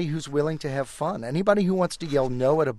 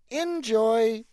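a delighted little squirrel; enthusiastic squirrel; 9 semitones higher than usual. Acted. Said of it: "Out walks a squirrel".

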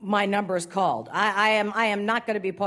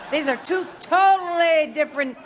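ordinary talk, nothing marked

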